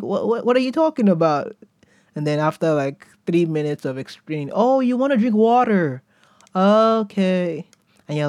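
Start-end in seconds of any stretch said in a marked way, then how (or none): 0.0-1.5 high, stammering confusion; confused. Acted. Confused American listener acted out. 4.5-7.6 put-on high, dawning realization in an American accent; mock an American finally getting it, drawn-out and high. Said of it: "how to speak like an American".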